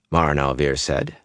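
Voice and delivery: usual speaking voice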